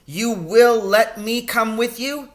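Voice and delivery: put-on boy raising his voice to his father. A narrator's reading. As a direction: push the voice up to be heard. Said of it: "raising my voice".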